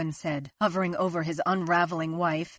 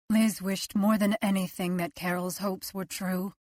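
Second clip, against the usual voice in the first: ordinary delivery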